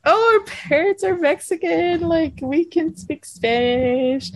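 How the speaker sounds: high, bright eager reply; chirpy explanation. Retold or imitated. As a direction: answer the guide brightly. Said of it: "I was like".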